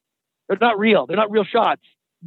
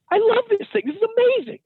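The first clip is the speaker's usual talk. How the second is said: much higher, gushing delight; thrilled. Retold or imitated. Driver finally enjoying the borrowed Lamborghini, high and giddy. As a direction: squeal it like a kid with his dream car. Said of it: "and I was thinking".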